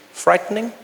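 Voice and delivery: usual speaking voice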